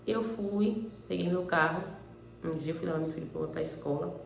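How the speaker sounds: usual speaking voice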